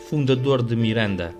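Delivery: ordinary talk, nothing marked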